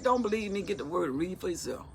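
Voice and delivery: ordinary talk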